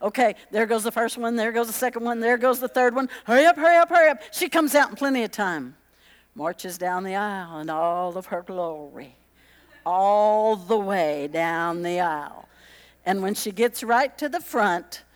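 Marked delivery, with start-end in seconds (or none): 3.2-4.2 high, loud hurry-up through a door; urgent calling. Retold or imitated. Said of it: "they're beating on the door". 6.4-12.5 mock stately. A narrator's reading. Mock grand bridal march.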